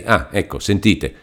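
usual speaking voice

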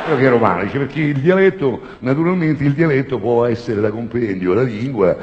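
high, loud lecturing voice; affected professor. Retold or imitated. Comedian voices his pompous professor friend, loud and affected. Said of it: "perché se parla così".

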